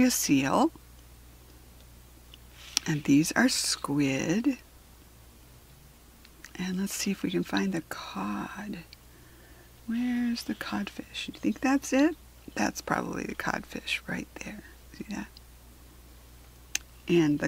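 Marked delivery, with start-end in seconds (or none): 2.9-12.2 hushed whisper. Acted. Whispering to the child while searching for the codfish. Searching the dark page quietly. 12.5-15.3 hushed whisper. Acted. Whispering to the child while searching for the codfish. Searching the dark page quietly.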